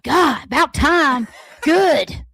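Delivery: loud gruff remark. Retold or imitated. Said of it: "He was like".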